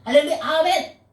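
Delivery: hurried, lightly voiced begging prayer; mock greedy prayer. Preacher mimics a selfish believer praying, hurried mock plea. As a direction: rattle off wishes like a self-serving pray-er. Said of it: "이렇게 기도한다는 거예요".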